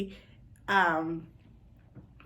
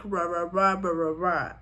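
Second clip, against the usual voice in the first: gibberish American R sounds; mock American accent; 3 semitones lower than usual. Woman swearing her accent won't change, mock American accent. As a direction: parody American speech as nonsense syllables. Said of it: "I will never speak".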